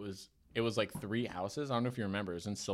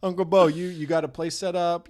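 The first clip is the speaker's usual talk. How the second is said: country caller; 7 semitones higher, 8 dB louder than usual. Retold or imitated. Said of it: "he's like".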